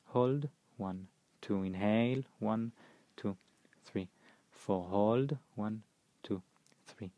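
calm, even counting of breath beats; slow breath counting. Acted. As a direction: count each breath phase steadily, fading on the last numbers. Leads counted pranayama breathing.

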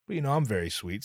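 normal talking voice

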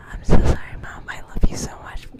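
whisper. Pretend. Whispered apology to mom.

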